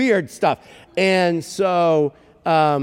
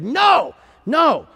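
high, loud retold no; frustrated protest; 11 semitones higher, 5 dB louder than usual. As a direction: cry out in protest, pitch jumping up. Frustrated man protests again.

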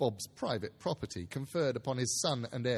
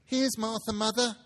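put-on high, louder young girl calling out; a daughter announcing herself; girl's voice; 9 semitones higher, 5 dB louder than usual. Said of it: "said a girl".

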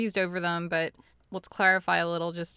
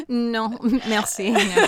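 playful refusal; 3 semitones higher, 7 dB louder, slower than usual. Real, not acted. Declines joining French, laughing.

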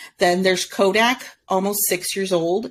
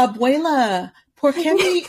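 high-pitched Spanish-speaking dog; dog's Spanish accent voice; 6 semitones higher than usual. Acted. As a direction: voice a pleading little dog in Spanish, close to laughing. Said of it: "I have a voice for him".

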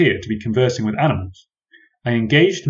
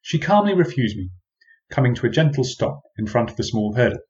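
usual speaking voice

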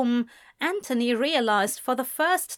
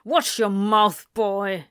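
louder stern warning; angry snap; 5 dB louder, slower than usual. A narrator's reading. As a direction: snap it down at the boy. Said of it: "James snapped".

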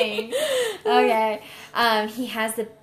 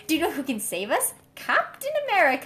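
put-on American accent; 5 semitones higher, faster than usual. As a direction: ham up the American hero call. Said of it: "that's how all Americans sound".

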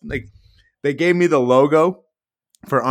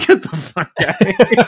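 mock incredulous outburst, higher and louder, laughing; incredulous disbelief; 8 semitones higher, 7 dB louder than usual. Security guard scoffing at Channel Seven Australia, laughing disbelief. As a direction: scoff at it like it can't be true.

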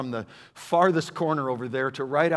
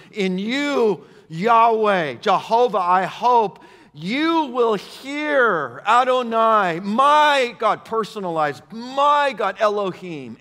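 loud, high scripture proclamation; emphatic preaching; 6 semitones higher, 7 dB louder, much slower than usual. Acted. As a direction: proclaim each divine name with force. Proclaims the verse with divine names.

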